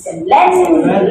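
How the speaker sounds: Miscellaneous with loud stressed LEN, slow. Shown as a demonstration. Teacher shows class the stressed LEN in Miscellaneous. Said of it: "Len yang dia tekan".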